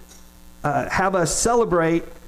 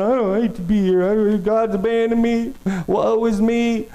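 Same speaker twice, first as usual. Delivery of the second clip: higher, mock whiny child, slowing on "Woe is me"; hammed-up self-pity; whining like a child; 4 semitones higher than usual. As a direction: whine it like a sulky little kid. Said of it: "whining and complaining and stomping like a little child".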